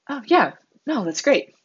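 high-pitched, placating fake enthusiasm; feigned polite interest. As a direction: act politely impressed without meaning it.

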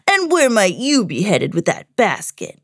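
lightly voiced nosy scoundrel; sly villain. Big Bad Pete eyes Minnie's basket, sly villain. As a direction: question her like a storybook bad guy.

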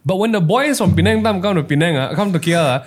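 normal talking voice

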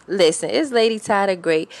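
usual speaking voice

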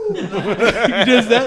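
slow, loud words through laughter; laughing. Real, not acted. Laughing through the Macho Man story. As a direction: talk while cracking up.

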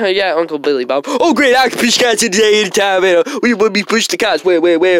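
loud, fast, hyped-up worker. Acted. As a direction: bounce on it, pumped to work.

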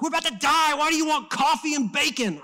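loud, high-pitched panicked shout; freaking out. Retold or imitated. Servant yelling at Elisha as the army closes in, freaking out. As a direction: act out a servant in full panic. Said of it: "freaking out, like".

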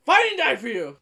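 cheering her on. Retold or imitated. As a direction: yell it like a fan in the stands. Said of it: "And I was like".

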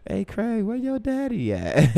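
very high-pitched woman's voice; high falsetto impression, slowing at the end; Miss Pearly. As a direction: put on a high, drawn-out flirty lady's call. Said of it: "it was Miss Pearly and Pops".

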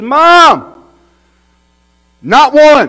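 put-on boy yelling joyfully at the top of his voice; excited shout. Boy shouting to his mom off the bus, thrilled.

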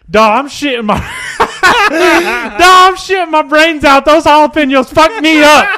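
high, loud laughing Dana voice; laughing through quote. Retold or imitated. Laughing as he reads out Dana's text reply. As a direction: crack up while reading his text aloud.

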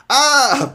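high, loud comic scream. A narrator's reading. Broom man sees the monster grow, screamed. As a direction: cry out like a terrified broom-wielder. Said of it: "Broom screamed".

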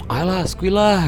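slow, soft coaxing; coaxing friend. Retold or imitated. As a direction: drawl it like a tempting friend. Friend urging him to drink.

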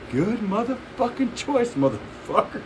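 high, drawn-out hammed-up exclamation; skit pitchman impression. Retelling a Dave Chappelle skit to co-host, hammed-up exclamation. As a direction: stretch it out high, aping the skit. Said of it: "one of the best Dave Chappelle skits".

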